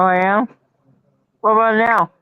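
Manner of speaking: ordinary talk, nothing marked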